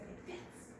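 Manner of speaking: breathless soft whisper; awed whisper. Acted. Bear amazed his fur fits, whispering. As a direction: whisper it in wonder.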